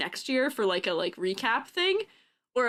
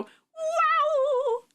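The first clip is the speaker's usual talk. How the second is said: big, high, drawn-out Mario-style "wow"; long, high cartoon launch cry; loud launch whoop. Shown as a demonstration. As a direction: let the high whoop ring out at full size. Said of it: "But that's too loud".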